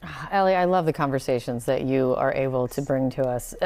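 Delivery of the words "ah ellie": usual speaking voice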